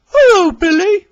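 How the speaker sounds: very high, loud purring diesel car; high, sly purred greeting; purring Daisy. Acted. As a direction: purr a teasing hello, way up high. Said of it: "she purred".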